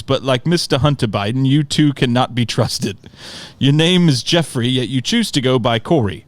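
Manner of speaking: mock-formal London uncle; butchered London accent. Uncle writes back to Corey on Facebook, butchered London accent. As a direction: put on a lofty, bad London accent. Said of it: "I'm sure I will butcher his accent".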